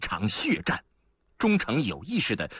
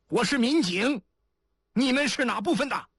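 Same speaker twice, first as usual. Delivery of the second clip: high-pitched answering call; calling back. A narrator's reading. Answering shouts from inside a ditch. (从沟里高声回喊)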